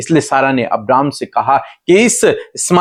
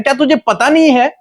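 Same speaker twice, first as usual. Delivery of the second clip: higher-pitched, insistent divine reassurance; God explaining; 8 semitones higher than usual. Retold or imitated. God explains his promise to the worried Hagar, raised insistent voice. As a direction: pitch it up and press each question home as God. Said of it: "परमेश्वर उसे समझाने की कोशिश कर रहे हैं".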